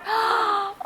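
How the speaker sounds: high sharp gasp; startled gasp. Acted. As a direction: gasp in fright. Ellie startled.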